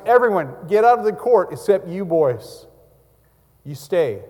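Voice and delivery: loud, high commanding call; loud cry. A narrator's reading. Joseph ordering everyone out, loud commanding cry. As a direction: cry out the order to clear the court. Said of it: "he cried out in a loud voice".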